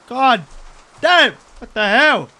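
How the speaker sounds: high, loud burst of surprise; shocked exclamation. Real, not acted. Player reacting to a big customer order, loud and high. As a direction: blurt it out at the huge order.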